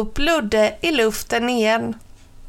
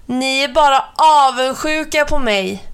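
high, loud boastful shout; a boastful towel shouting at the birds; 4 semitones higher, 4 dB louder than usual. A narrator's reading. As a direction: yell it after the birds, high. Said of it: "skrek Ludde".

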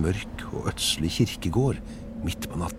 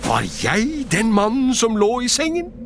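high, alarmed cry; 14 semitones higher, 4 dB louder than usual. A narrator's reading. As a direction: call out in a much higher voice. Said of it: "og ropte ut". (høyt, oppskremt rop)

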